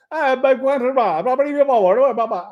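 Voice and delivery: rapid, high mock-Italian chatter; Italian driver. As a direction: jabber like a chatty Italian cabbie, hands flying. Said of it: "Luigi's going".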